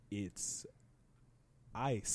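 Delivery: quiet, flat "It's ice."; quiet stunned deadpan. Retold or imitated. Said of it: "I'm literally so surprised".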